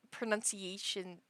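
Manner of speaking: usual speaking voice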